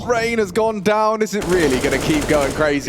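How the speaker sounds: rising hype play-by-play; esports caster. Acted. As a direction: call the action, building excitement. Hyped play-by-play call.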